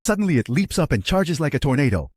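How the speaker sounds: ordinary delivery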